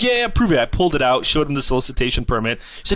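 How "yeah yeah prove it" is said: high, huffy mock threat; threatening homeowner. Homeowner threatening to call the cops, high huffy voice. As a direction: puff up like a petty tyrant.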